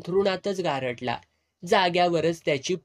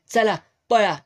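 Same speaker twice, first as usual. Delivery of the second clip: urgent shout; 5 semitones higher, 6 dB louder than usual. Acted. Boss urging men to run.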